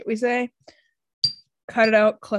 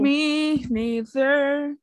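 silly drawl; 4 semitones higher, much slower than usual. Acted. Goofy drawn-out voice.

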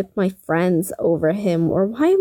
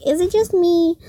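higher repeat; 8 semitones higher than usual. Shown as a demonstration. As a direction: say it again, higher. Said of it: "Again, I'm just gonna say".

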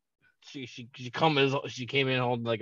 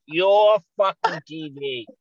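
loud, slow mock scolding; higher, drawn-out indignant yell; mom's angry accusation; 5 semitones higher, 7 dB louder, slower than usual. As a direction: imitate a furious mother hammering each word. Mother's accusation continues.

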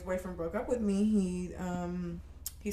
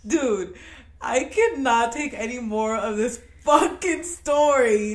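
slow, fed-up mock protest, pitched up; mock exasperation; 5 semitones higher, 5 dB louder, slower than usual. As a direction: act out being unable to take any more. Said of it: "I'm like".

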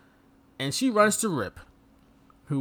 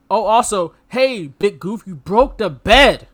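louder, slow scolding; mock Samantha; 7 dB louder, slower than usual. Samantha scolding Rip about the bed, mild mock scold. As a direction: tell him off calmly. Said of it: "She just said".

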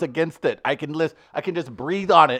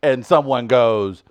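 normal talking voice

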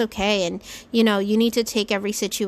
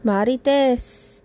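slow, higher and louder auntie caller; 5 semitones higher, 4 dB louder, slower than usual. Acted. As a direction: play a doting auntie leaving a voicemail. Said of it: "it's your Auntie Mari again".

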